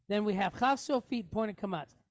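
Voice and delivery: ordinary delivery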